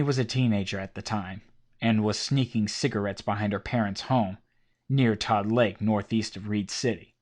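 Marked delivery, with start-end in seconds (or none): none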